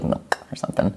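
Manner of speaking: whispers the idea; half-whispered suggestion. Real, not acted. Musing about mellowing the drink, whispered.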